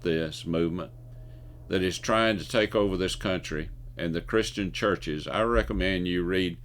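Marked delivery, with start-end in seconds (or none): none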